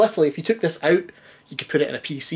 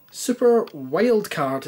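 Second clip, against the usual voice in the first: slow, raised grand announcement; proud title reading; 5 semitones higher, much slower than usual. Acted. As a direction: read the name out like a bold headline. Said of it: "in really, you know, proud, bold lettering".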